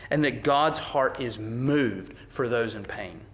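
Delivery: ordinary talk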